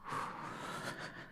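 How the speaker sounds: long airy sigh; soft sigh. Acted. Vampire sighing as his beloved gazes at him, soft sigh. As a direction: let out a quiet, lingering sigh. Tender moment in whispered roleplay.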